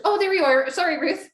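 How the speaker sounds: ordinary talk, nothing marked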